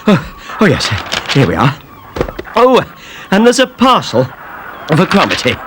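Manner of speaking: lightly out of breath; puffing from the stairs. A narrator's reading. Eddie after climbing the stairs, a touch out of breath. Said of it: "came puffing up the stairs".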